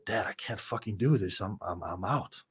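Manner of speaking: a quiet, cracking plea to his dad, near tears; sinking low and hushed as he falls apart on the phone; breaking down. Retold or imitated. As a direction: let the voice drop and crumble, half-reliving the call. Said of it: "I break down".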